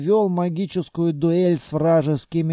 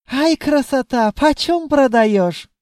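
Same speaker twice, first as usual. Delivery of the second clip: put-on high, loud accented djinn haggler; admiring djinn; 8 semitones higher, 6 dB louder than usual. A narrator's reading. Djinn asks to buy the beautiful treasury, admiring. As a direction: gush loudly in a high accented voice.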